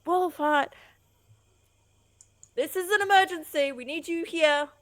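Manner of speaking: high, loud emergency call; urgent shouting. Acted. Player pounding on the leader's door, urgent shouting. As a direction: shout through a door to rouse them. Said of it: "pounding on the, um, door, going".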